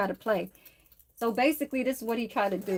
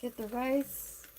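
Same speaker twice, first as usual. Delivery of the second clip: usual speaking voice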